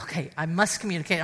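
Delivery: hushed quick whisper to herself. Retold or imitated. Wife whispers a pep talk to herself, whispered. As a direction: breathe it out as a private resolve. Whispered self-instruction in story.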